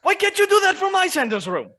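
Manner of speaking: high, loud exasperated demand; exasperated protest. Acted. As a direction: throw up your hands at him.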